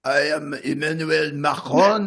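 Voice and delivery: slow, heavy mock French accent; Macron impression. Comedian playing Macron to the hosts in a hammed-up French accent. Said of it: "so that's my Emmanuel Macron impression".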